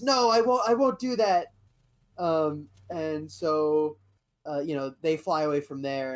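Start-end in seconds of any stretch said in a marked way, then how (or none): 0.0-1.5 high, loud no; defiant refusal. Retold or imitated. Wim refusing Luke, high and loud. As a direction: shout it like a stubborn kid. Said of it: "And Wim goes".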